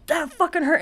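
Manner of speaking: high, pained protest; pained complaint. Retold or imitated. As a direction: complain right after the bite. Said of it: "I'm like".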